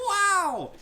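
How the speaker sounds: very high, loud "wow"; hammed-up soundboard wow; mock amazement. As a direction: exclaim it with exaggerated wonder. Put-on amazement after joke.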